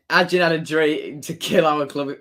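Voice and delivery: usual speaking voice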